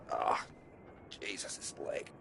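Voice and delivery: breathless whisper through leg pain; wincing in pain, barely voiced; pained groan. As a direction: hiss it out, hurting. Injured leg pains him.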